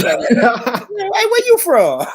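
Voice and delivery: high, loud mock British asking; a Brit asking where you're from, overdone; British accent impression. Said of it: "I can just hear it".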